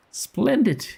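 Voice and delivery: a hint of an eager shopkeeper accent; attempted character accent. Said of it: "I can't do his accent".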